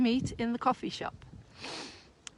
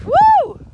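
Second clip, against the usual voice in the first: loud high whoop; joyful whoop; 10 dB louder than usual. Real, not acted. Joyful cry.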